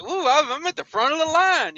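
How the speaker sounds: mock happy voice, pitched high; a hint of a gleeful simpleton; giddy trucker. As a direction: play him beaming and clueless. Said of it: "smiling and all like".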